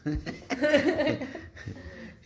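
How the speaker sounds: high bursting laugh at his own joke; burst of laughter. Real, not acted. Speaker laughing at his own joke in class, high laugh. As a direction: laugh out, amused at himself.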